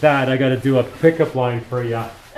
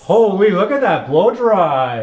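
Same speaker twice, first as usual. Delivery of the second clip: slow, higher-pitched gushing; drawn-out admiration; 4 semitones higher, 3 dB louder, slower than usual. Real, not acted. Hairdresser hyping a client's blow dry, drawn-out admiration. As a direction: stretch out the words in showy awe.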